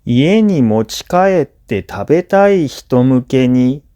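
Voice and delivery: ordinary talk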